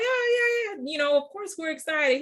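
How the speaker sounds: a sudden high squeal of forced enthusiasm; telling the instructor she's excited on video, squealing; over-the-top excited. Retold or imitated. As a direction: gush yes as if thrilled for the camera. Answering instructor's camera question.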